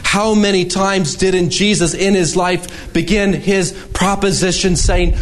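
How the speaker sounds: usual speaking voice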